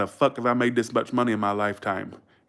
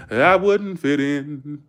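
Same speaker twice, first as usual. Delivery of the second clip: slow, louder, stretched-out Southern twang; playful Southern drawl; 3 semitones higher, 5 dB louder, much slower than usual. Pretend. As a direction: draw each word out in a mock Southern drawl. Drawled repeat of same words.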